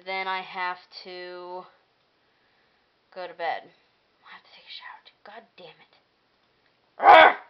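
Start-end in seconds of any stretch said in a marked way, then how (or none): 4.2-5.9 unvoiced whispered grumble. Real, not acted. Vlogger grumbling to viewers about chores, whispering. 7.0-7.4 loud frustrated ugh; loud groan. Real, not acted. Exasperated groan.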